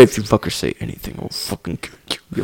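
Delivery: low threat sinking into a whisper; mock hushed threat. Baldwin warning witnesses after the shooting, mock whispered threat. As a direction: drop to a whisper as you warn them.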